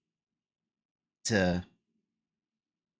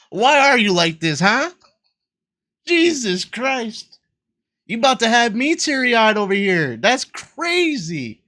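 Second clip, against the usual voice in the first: incredulous protest; 12 semitones higher, 8 dB louder than usual. Real, not acted. Player nearly teary over the story, incredulous. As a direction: stretch the words in pained disbelief.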